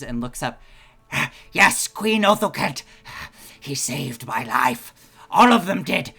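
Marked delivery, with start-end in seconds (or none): 1.5-6.1 loud, raised voice to a queen; impassioned plea. Acted. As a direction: plead loudly for the man who saved you. Game master voices Oshawott pleading aloud.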